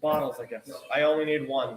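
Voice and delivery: gruff character voice. Acted. Player voicing low character.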